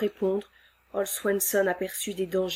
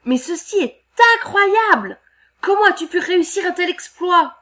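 much higher, loud astonishment; amazed; 10 semitones higher, 9 dB louder than usual. Acted. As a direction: marvel at the feat. Amazed lumberjacks.

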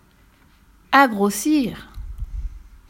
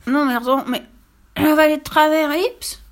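put-on hiccuping child; 7 semitones higher, 5 dB louder than usual. Hiccuping boy protests.

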